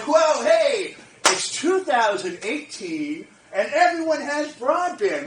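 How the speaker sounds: loud, slowed astonished announcement; mock amazed whoa. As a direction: act the world suddenly waking up. Imitating everyone suddenly noticing.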